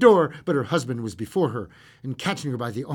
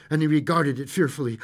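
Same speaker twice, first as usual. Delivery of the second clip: ordinary delivery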